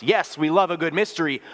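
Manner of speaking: normal talking voice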